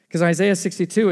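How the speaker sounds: ordinary delivery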